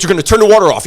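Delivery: high, loud imitation of a bossy order; grandfather laying down orders. Grandfather to delivery guy at the running tap, ordering. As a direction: lay down the law as the old boss. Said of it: "he goes".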